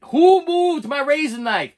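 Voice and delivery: high, loud and slow indignant yell; annoyed call. Retold or imitated. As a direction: demand to know who took it, loud. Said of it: "I call it".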